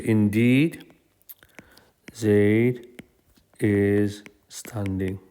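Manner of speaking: "Indeed" drawn out and rising, then long pauses; slow, spaced out, with "Indeed" held; stress on "Indeed". Shown as a demonstration. Teacher demonstrates emphasis to students, stretching "Indeed". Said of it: "But we will say".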